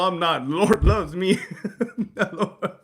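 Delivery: ordinary delivery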